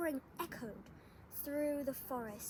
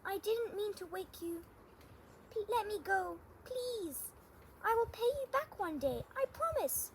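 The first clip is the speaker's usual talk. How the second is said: high-pitched, urgent little mouse; squeaky pleading mouse; 8 semitones higher, 3 dB louder than usual. A narrator's reading. Mouse begging Lion to let it go, squeaky pleading. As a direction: plead high, rising into an insistent promise. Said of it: "squeaked Mouse".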